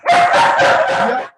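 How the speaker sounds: a thrilled squeal to set the dogs off; excited shriek yeah. Shown as a demonstration. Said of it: "maybe I'll give you an example".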